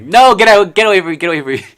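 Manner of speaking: high, loud, frantic mock shriek; acted panic. Vlogger acting out seeing beetles now, panicked shriek. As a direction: shriek as if fending off a flying bug. Acts out reaction to seeing bugs.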